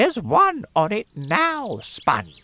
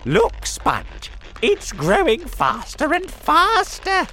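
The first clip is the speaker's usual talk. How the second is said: excited aunt; 8 dB louder than usual. Acted. Aunt excitedly calling sister.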